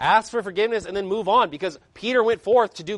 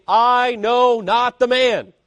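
higher, louder and very slow, a furious oath; cursing denial; 7 semitones higher, 9 dB louder, much slower than usual. A narrator's reading. Peter swears to the bystanders he never knew Jesus, furious slow oath. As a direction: spit the denial out as Peter curses. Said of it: "Then began he to curse and to swear".

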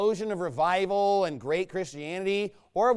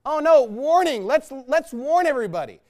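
mock alarm; 5 semitones higher, 7 dB louder than usual. Mocking Hollywood warnings.

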